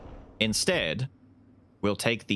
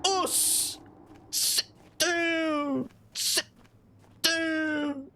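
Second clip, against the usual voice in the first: high crying yell; high strained yell; much slower than usual. Real, not acted. Gamer yelling at an enemy while beating it down, high strained. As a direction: yell it as you strike.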